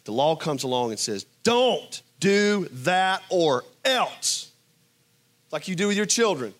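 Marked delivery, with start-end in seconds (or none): 1.4-4.5 slow, stern warning in a put-on parent voice; threatening parent. Preacher voicing the law as a parent, slow and menacing. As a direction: drag it out like a threat.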